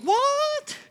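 the frightened women jumping at the angel; startled what. Acted. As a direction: yelp it out, caught off guard.

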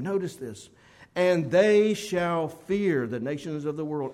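loud, solemn pulpit reading of the verse; preaching the scripture; forceful scripture reading. Acted. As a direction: proclaim the verse with full force, then ease off at the end. Preacher reads scripture aloud.

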